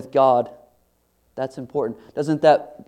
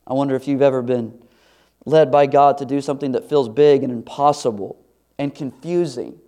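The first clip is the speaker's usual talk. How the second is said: ordinary talk, nothing marked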